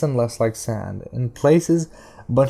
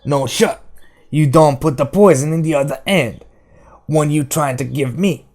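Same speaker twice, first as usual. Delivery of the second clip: higher-pitched accusing dialect voice; Detta, defiant and suspicious; 4 semitones higher, 5 dB louder than usual. A narrator's reading. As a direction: refuse it like you smell a trick. Narrator voices Detta refusing food.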